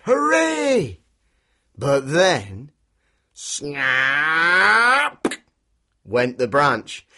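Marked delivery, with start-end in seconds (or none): 0.0-1.0 high, loud, jubilant cheer; big drawn-out hooray. Acted. Cheering the rescued kitten. 3.3-5.3 long, swelling, rising snap with sharp end; drawn-out breaking-branch effect; sound-effect word. Acted.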